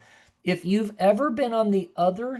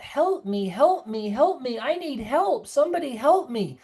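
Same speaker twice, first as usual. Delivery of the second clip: imitated calling out; 8 semitones higher than usual. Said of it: "or they yell out".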